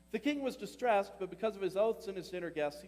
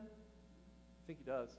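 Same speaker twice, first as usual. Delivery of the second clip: much softer, slowed, lower; tender aside; 7 semitones lower, 11 dB quieter, much slower than usual. Real, not acted. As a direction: hush it, almost to yourself.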